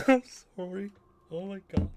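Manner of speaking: high, loud words squeezed out between laughs; helpless laughing apology. Real, not acted. Player apologising to viewers mid laughing fit, cracking up. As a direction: apologise while unable to stop laughing.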